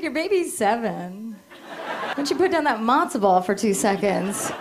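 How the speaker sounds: ordinary delivery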